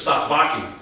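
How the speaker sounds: ordinary delivery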